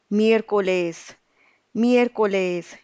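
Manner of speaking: Miércoles with miér stressed, slow and clear. Shown as a demonstration. Teacher modelling Miércoles for learners, stressed and slow. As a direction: lean on the first syllable of Miércoles. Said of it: "So we'll have to stress it out".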